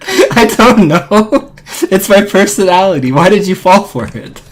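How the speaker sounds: talking through loud laughter; laughing. Real, not acted. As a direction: laugh hard while teasing. Teasing friend, laughing hard.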